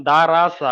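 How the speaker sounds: "রা" light, with an open aa; thin "রা". Shown as a demonstration. Teacher showing the thin reading is possible, "রা" light. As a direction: say raa unrounded in দা রা সা. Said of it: "বারিকের উচ্চারণ করা যাচ্ছে".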